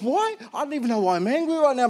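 high, fast, baffled outburst; exasperated self-questioning. Retold or imitated. Pastor asks himself why anger comes, exasperated. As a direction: question yourself in rising frustration.

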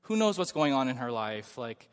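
calm friend talking him down, soft and reasonable. Retold or imitated. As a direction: soothe an angry friend gently. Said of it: "he's just really calm".

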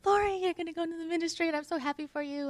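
high, fast, gushing quote; excited coworker. Retold or imitated. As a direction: gush like a thrilled friend. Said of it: "she was so excited".

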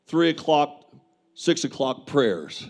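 ordinary talk

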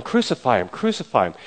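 normal talking voice

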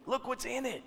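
soft, high, drawing listeners in; hushed repeat. Real, not acted. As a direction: drop the volume and let it sink in. Same line repeated much softer.

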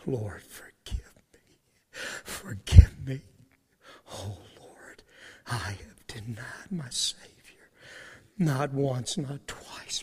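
broken, breathy whispered confession, low; weeping whisper. Acted. Peter weeping against the wall, whispered anguish. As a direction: sob the words out in a hush. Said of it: "he begins to weep and he says".

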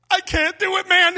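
friend backing out. Retold or imitated. Friend Justin telling the pastor he quits, loud and dramatic. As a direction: throw up your hands and quit. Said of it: "he says to me".

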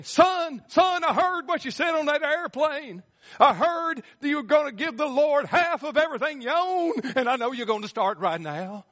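high, loud, calling preacher voice; eager preacher. Retold or imitated. Preacher runs to the businessman, high loud eager calling. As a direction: hail him like a greedy preacher. Preacher running across tarmac.

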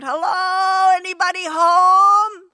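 high, loud mock call into an empty house. Praying believers calling out to Jesus, loud and high. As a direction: call out as if knocking on a silent door.

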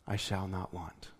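hushed and low, released on the out-breath; soft breath prayer. Shown as a demonstration. Pastor demonstrates breathing out the verse, hushed. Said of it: "And then you breathe out".

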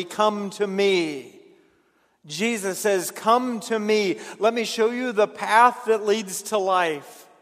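fervent invitation. Acted. Jesus inviting, preached strongly.

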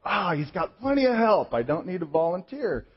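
loud, high burst of mock relief; relieved self-excuse. Man letting himself off the hook from volunteering, loudly relieved. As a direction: let yourself off the hook loudly.

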